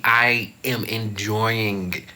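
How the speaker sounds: usual speaking voice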